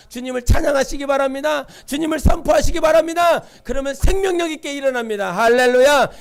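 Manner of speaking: loud, fervent exhortation building to a shouted hallelujah; preacher shouting the close, full force; rousing call. Real, not acted. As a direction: whip the congregation up.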